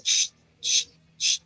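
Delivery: whispered shh like pouring sand; sand hissing. Retold or imitated. First boss from Hellboy turning his hand, whispered sand hiss. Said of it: "he goes".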